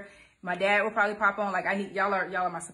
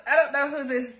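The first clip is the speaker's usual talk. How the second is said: laughing; 5 semitones higher, 6 dB louder than usual. Real, not acted. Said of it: "stop laughing".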